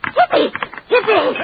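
high squawking cheer; parrot squawk. Acted.

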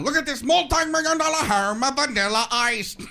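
Robin Leach. Retold or imitated. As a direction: present it like an overblown luxury-show host. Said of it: "Robin Leach isn't laughing".

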